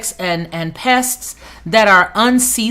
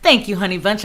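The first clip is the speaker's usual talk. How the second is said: ordinary talk, nothing marked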